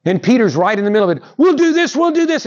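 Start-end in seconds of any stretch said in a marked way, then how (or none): 1.4-2.5 high, fast, eager Peter voice. Retold or imitated. Preacher voicing Peter rushing to build temples, high and eager. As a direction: Peter blurting his plan, much higher.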